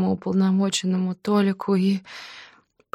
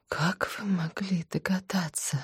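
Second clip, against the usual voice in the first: frightened whisper; 2 semitones higher, 8 dB quieter than usual. Acted. Stunned woman asks inspector.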